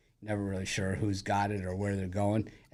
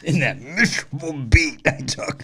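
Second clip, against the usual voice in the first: mocking imitation; 5 semitones higher, 10 dB louder than usual. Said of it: "Chris is like".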